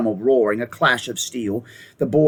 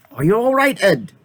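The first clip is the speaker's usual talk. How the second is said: high worried call; anxious boy; 8 semitones higher than usual. A narrator's reading. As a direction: call out in the dark.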